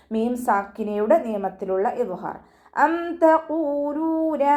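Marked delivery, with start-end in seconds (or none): 2.9-4.4 slow, high chanted phrase; tajweed recitation. Shown as a demonstration. Teacher demonstrates the correct reading, slow chant. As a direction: draw out the words in recitation. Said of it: "അം തഖൂലൂന എന്ന് ഓതരുത്".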